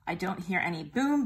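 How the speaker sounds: plain reading voice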